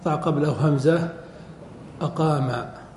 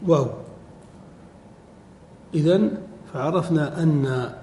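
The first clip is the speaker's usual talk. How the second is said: normal talking voice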